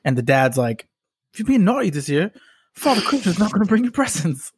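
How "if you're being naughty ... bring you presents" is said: imitating a Cockney dad, pitched up; a rough mock Cockney accent from a British dad. Dad scolding his kid in a mock Cockney accent. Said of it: "mad Cockney accent".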